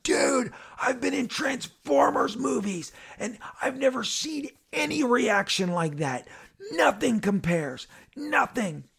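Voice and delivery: high-pitched, awestruck raving; amazed exclaiming. Retold or imitated. T J at the party after drinks, high amazed exclaiming. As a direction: pitch it way up in astonished disbelief.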